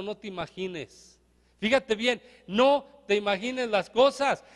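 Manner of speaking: usual speaking voice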